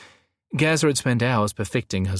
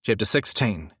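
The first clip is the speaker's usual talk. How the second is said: ordinary delivery, nothing marked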